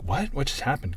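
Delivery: lightly acted double take; mock bafflement. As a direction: play a puzzled viewer reacting. Viewer's confused reaction acted out.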